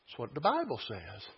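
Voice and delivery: "That's what the Bible says" low and soft; understated assertion. Real, not acted. Preacher cites the Bible to congregation, understated. First take, before emphatic repeat.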